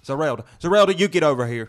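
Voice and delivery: put-on Missouri accent. Mock parent calling Zerelda over, Missouri accent. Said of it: "say it in a Missouri accent".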